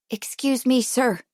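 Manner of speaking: plain reading voice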